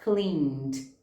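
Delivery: "Cleaned" ends n straight into d, one syllable; teacher models "Cleaned" as clean-d. Shown as a demonstration. Teacher shows students the past tense. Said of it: "você vai ler cleaned".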